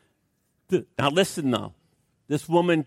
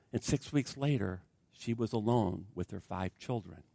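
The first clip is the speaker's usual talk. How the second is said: hushed grief; 5 semitones lower, 12 dB quieter than usual. Retold or imitated. Widow retells being left alone.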